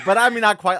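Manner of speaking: ordinary delivery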